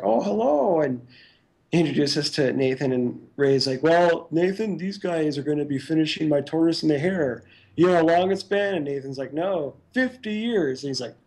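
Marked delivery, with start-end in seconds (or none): 0.0-0.8 high, put-on old man's hello; a hint of an elderly host; old man greeting. Nathan waddling up, greeting. 3.8-8.8 a hint of an old man's teasing. Ray introducing them to Nathan. 9.3-10.8 high, slow, put-on deadpan; lightly voiced old man, slow. Nathan answering Ray.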